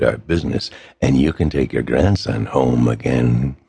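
low, gravelly threatening voice; a cold kidnapper laying down terms; gravelly phone villain, low and menacing. A narrator's reading.

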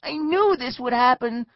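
high, loud wail of complaint; dismayed cry. A narrator's reading. Neil seeing escape vanish, loud dismayed cry. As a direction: cry it out in alarm. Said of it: "Neil cried".

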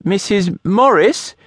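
high, startled, stress on Morris; man recognising a dreaded neighbour, aghast; horrified surprise. A narrator's reading. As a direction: gasp out her name in dismay. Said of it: "his eyes went wide with horror".

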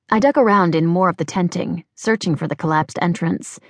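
usual speaking voice

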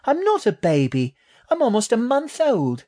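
very high, indignant little voice; high-pitched young creature standing up for herself; bristling baby rat. A narrator's reading. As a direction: protest as an offended tiny rat. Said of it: "Eve bristled".

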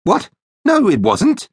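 high-pitched indignant denial; protesting cry. A narrator's reading. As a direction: cry out against a false accusation. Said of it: "Joe cried".

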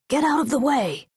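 high-pitched urgent command; barked order. Acted. Guards ordering a cart out of the procession's path, urgent high command. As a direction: call it out high, clearing the road. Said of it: "Guards at the front ordered".

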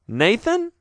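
put-on high girlish question rising; puzzled girl asking again. A narrator's reading.

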